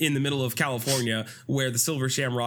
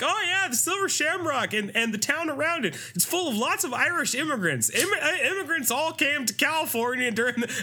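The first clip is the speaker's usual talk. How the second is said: high, cheery info-dump voice; chirpy townsfolk; 13 semitones higher than usual. Retold or imitated. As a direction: recite trivia like a perky tour guide. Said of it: "they're like".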